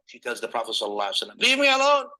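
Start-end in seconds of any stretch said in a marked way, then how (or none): 1.4-2.1 raised, higher snap of leave me alone; sharp retort. Acted. Grieving woman rebuffs the passerby, loud and sharp. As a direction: push him away with your voice.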